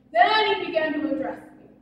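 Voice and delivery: ordinary talk, nothing marked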